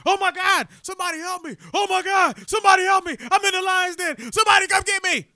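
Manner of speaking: very high, loud mock-terrified cries for help; panicked screaming. Man trapped in the lion's den calling for help, comic screaming panic. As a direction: scream for rescue in hammed-up panic. Joke man fallen among lions.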